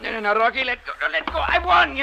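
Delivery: high frantic protest, rising; rising panicked no-no-no; panicked pleading. Acted. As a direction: beg him to let go, panicking. Panicked struggle over diving suit.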